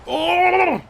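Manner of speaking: high, loud startled Oh; surprised shout. Real, not acted. Startled shout while hearing about cassowaries. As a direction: yelp in sudden surprise.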